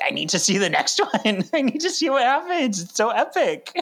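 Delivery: high, fast, eager gushing; excited. Retold or imitated. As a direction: gush like an impatient fan. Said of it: "it was so, like".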